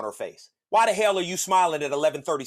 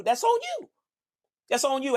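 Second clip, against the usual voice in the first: high squeal; 10 semitones higher than usual. Acted.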